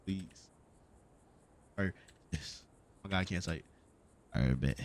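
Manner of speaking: hushed, private grumbling; muttering to self. Real, not acted. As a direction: talk under your breath as you type. Muttering to himself while typing.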